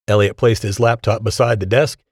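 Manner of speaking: ordinary delivery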